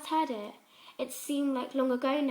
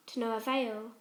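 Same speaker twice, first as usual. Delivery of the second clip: plain reading voice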